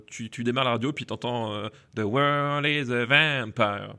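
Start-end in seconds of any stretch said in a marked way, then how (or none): 2.0-4.0 slow nasal duck-like singing voice; voix de canard. Retold or imitated. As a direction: imitate [name]'s duck voice. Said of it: "tu fais bien la voix de canard". (voix de canard imitée, lente)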